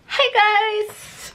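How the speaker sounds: high-pitched cheerful hello, covering up a fall; bright excited greeting. Real, not acted. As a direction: pop back on camera with a big cheery hello. Greeting right after a fall.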